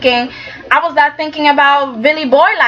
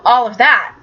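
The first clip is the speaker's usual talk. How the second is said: ordinary talk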